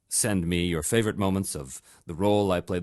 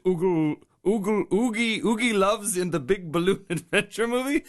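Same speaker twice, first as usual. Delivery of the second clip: higher and amused, tripping over the title; stumbling amused; 8 semitones higher than usual. Real, not acted. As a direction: giggle through the name you can't say.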